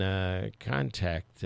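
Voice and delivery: ordinary delivery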